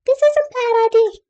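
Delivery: tiny, very high-pitched squeak of a voice; little voice. Acted. Intuition warning against letting the boy drive, tiny squeaky voice. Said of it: "There's this little voice".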